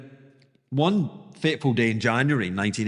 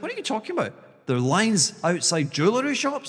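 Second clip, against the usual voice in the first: mock astonished Jim; 5 semitones higher, faster than usual. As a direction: play Jim's astonishment up high. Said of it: "Jim's like".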